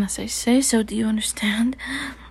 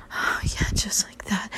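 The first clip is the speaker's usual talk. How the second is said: whispered. Acted. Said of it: "same words whispered then voiced".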